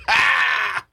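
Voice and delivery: high-pitched cry of pretend horror; mock scream. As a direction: cry out in playful dismay. Mock horror at bad holiday weather.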